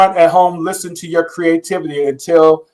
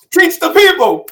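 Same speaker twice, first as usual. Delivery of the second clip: egging on; 11 semitones higher than usual. Pretend. Cheering the coach on.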